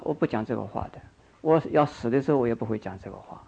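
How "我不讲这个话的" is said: ordinary talk, nothing marked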